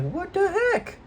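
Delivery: very high squeaky cartoon voice; squeaky high Michael voice. Kid voicing Michael finding the secret room, squeaky. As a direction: jump way up into a squeak.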